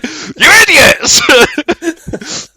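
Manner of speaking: high, long-drawn villain's yell; outraged shout. Retold or imitated. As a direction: act out a duped villain's slow, high howl. Outraged villain when trick is revealed.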